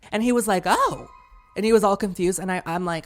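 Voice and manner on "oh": surprised. Retold or imitated. Guy confused.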